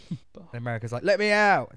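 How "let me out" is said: high, loud mock plea from a prisoner; shouting. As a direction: shout it like someone locked in a cell. America shouts from a prison cell.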